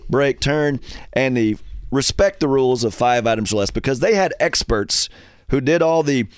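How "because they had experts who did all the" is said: ordinary talk, nothing marked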